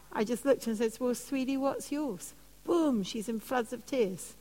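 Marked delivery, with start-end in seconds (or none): 1.0-2.3 softer, gentle question; tender reply. Retold or imitated. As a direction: soften it like asking a friend. Gently turning question back.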